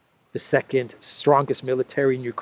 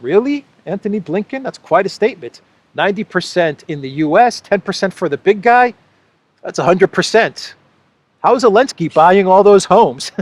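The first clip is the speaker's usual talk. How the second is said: higher, louder sarcastic questions; sarcastic disbelief; 5 semitones higher, 5 dB louder than usual. Real, not acted. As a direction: needle him with mock disbelief. Sarcastic incredulous rant.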